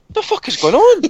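high, loud disbelief; incredulous. Real, not acted. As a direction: ask it in outraged disbelief. Incredulous outburst about the pictures.

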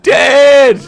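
put-on very high, loud child's scream; anguished shout. Boy learning his dad is dead, screamed high. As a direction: cry out for your father, hammed-up. Boy cries out at father's death.

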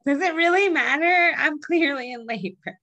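high, drawn-out mock protest; exasperated retort. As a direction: wail it like a fed-up patient. Acts out exasperated woman in labor.

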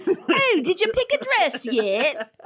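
very high, drawn-out mock British accent; bad British accent. Stage sister asking about a wedding dress, bad British accent. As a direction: overdo a fake British accent. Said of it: "was doing a British accent".

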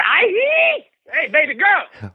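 high sleazy catcall voice; leering catcall. Acted. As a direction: call out to a girl, leering. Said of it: "give me a little bit of cat call".

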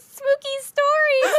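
high, drawn-out laughing delivery; playful spooky announcement. Pretend. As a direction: stretch it out high through laughter. Show's catchphrase, sung out while laughing.

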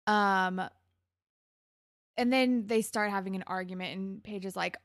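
ordinary delivery

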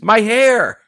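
loud, high-pitched mock shriek; mock scream. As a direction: imitate her screaming in outrage, high and loud. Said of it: "and she screams".

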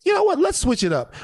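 high, playful mock cop voice; high mock scheming cop. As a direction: voice a cop plotting mischief, high. Voicing cops scheming.

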